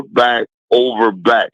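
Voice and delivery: ordinary talk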